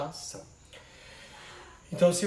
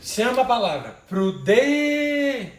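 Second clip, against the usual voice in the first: calling out the word; 6 semitones higher, 8 dB louder, slower than usual. Shown as a demonstration. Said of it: "A palavra que demorar mais é a tônica".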